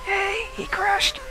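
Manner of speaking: high, slow whispered glee. Pretend. Gamer gloating quietly that the rival crashed, mock whisper. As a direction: breathe it out like a guilty secret.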